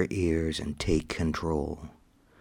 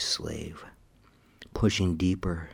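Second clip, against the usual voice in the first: ordinary talk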